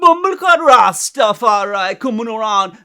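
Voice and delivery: loud, high mock Jamaican bluster; fake Jamaican accent. Imitating a wannabe Jamaican gangster from Oakland, loud fake accent. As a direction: shout it out in a put-on Jamaican accent. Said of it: "he had a fake Jamaican accent".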